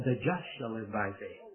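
"just" leaned on, the rest low and soft; stress on JUST. Retold or imitated. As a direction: put the weight on "just", then let it fall away. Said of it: "put the emphasis on the just".

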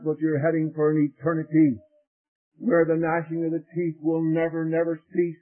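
ordinary talk, nothing marked

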